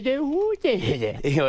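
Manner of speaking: high-pitched silly babbling impression; Adam Sandler gibberish. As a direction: babble nonsense in a high goofy voice. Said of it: "he does the thing where it's like".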